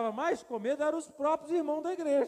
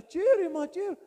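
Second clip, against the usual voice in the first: higher, louder alarmed yelp; panicked cry; 8 semitones higher, 9 dB louder than usual. Retold or imitated. Young pastor alarmed by the sister's news, panicked high cry. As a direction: blurt it out in fright. Panicked reply about gunshots.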